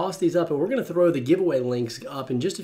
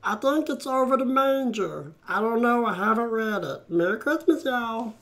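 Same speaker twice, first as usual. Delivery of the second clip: Meatwad impression; 11 semitones higher than usual. Host plays Meatwad selling a comic book, very high cartoon voice. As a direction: talk like a squeaky little meatball pitching a book. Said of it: "my Meatwad impression".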